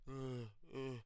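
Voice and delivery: imitating a knocked-out fighter's faint groans; mock groaning. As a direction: groan like you just woke from a choke. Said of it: "spread eagle going".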